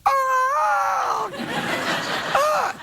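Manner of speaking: high shouted caw; drunken raven caw. Retold or imitated. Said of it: "translated The Raven by going".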